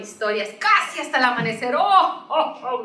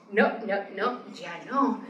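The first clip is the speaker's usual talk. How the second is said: hushed; 4 semitones lower, 6 dB quieter than usual. Acted. Hushing while bear wakes.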